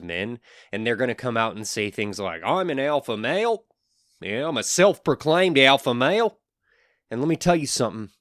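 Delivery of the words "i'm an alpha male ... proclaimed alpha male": high, loud mocking boast; mock alpha-male bragging; self-proclaimed alpha male. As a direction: puff it up as a ridiculous show-off. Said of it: "alpha males don't talk like that".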